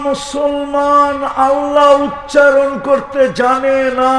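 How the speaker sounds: slow, loud, pounding sermon cry; drawn-out fiery shout from the pulpit; preacher's shout. Acted. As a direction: hammer each word like a warning. Preacher repeats the claim forcefully.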